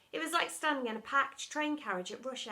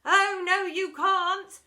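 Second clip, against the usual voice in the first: high, loud chant; the entire school chiming back at the deputy head; whole school chiming; 9 semitones higher, 12 dB louder, much slower than usual. A narrator's reading. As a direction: chime it like a crowd. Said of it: "chimed the entire school".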